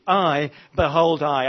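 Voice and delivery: both I's hit loud and drawn out; stress on I. Shown as a demonstration. Preacher showing whom the verse emphasizes, stress on I. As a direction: lean hard on each "I". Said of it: "It, it emphasizes God".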